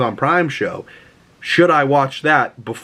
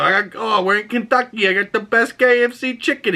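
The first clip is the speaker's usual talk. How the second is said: higher, lightly put-on chauffeur voice; the driver offering chicken; 10 semitones higher than usual. Retelling Green Book, driver offers Doc chicken, light put-on voice. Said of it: "he go".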